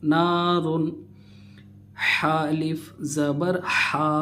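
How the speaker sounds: usual speaking voice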